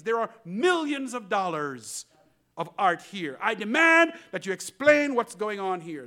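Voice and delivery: loud, high indignant demand; angry demanding collector. Acted. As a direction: demand answers, outraged.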